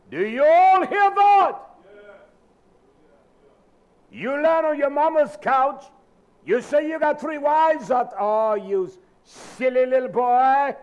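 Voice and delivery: high, loud, slow scolding cry; preacher scolding the congregation, loud; loud rebuke. Real, not acted. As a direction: thunder down at the men.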